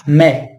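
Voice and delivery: mais said "mè", no s; French mais as mè. Shown as a demonstration. Teacher giving the spoken form of mais. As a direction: clip mais to mè. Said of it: "a gente pronuncia".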